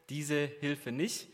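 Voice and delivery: normal talking voice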